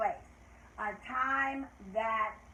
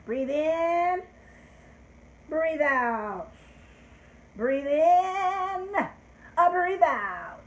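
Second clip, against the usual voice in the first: loud, higher breathing prompts; breathing cue; 4 semitones higher, 6 dB louder, much slower than usual. Shown as a demonstration. Teacher leads children in deep breaths before counting. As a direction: lead the class through deep breaths. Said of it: "take a deep breath".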